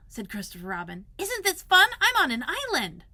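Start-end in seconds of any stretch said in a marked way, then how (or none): 1.2-3.0 put-on high, bright boyish delight, louder; a small boy thrilled to be on an island; excited boy. Christopher Robin to Owl, excitedly showing off his island.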